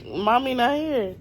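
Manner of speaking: loud slow teasing; playful denial to the child; pretend mommy voice.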